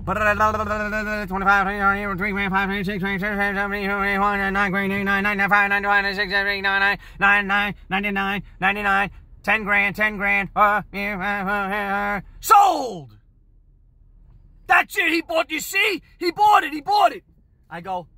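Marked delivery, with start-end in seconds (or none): 0.0-13.2 auctioneer. Retold or imitated. Imitates auctioneer on the tape. 14.7-17.2 high, loud shouted insistence; triumphant shout. Retold or imitated. Manager crows over the tape.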